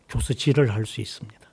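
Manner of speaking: barely voiced aside; whispered punchline. Real, not acted. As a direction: sink to a whisper at the end.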